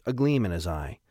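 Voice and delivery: usual speaking voice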